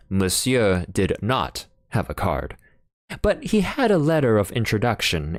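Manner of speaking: ordinary delivery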